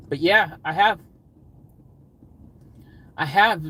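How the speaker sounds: ordinary talk, nothing marked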